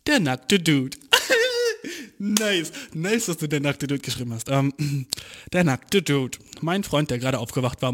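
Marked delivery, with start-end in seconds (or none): 1.0-2.2 sudden shrieking laugh; high burst of laughter. Real, not acted. Laughs at the naked dude.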